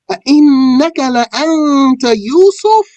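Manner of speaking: high astonished chant, ending as a question; mind-blown recitation. Acted. Brothers asking if he is truly Joseph, astonished. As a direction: chant it high in amazed disbelief. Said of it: "These brothers are mind blown here".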